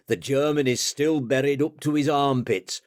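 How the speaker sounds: ordinary delivery, nothing marked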